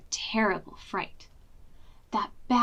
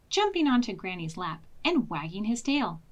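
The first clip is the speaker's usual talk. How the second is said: usual speaking voice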